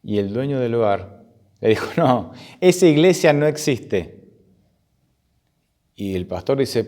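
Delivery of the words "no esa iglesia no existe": higher, slow, emphatic contradiction; emphatic denial. Acted. Bar owner contradicts the pastor about his church, emphatic denial. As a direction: deny it slowly and firmly, pitch raised.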